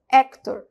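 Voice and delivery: "Actor." with an open E, like "éctor". Shown as a demonstration. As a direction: open the first vowel of "Actor." wide. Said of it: "é um E aberto".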